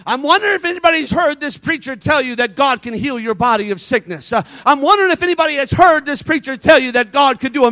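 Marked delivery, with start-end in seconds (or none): none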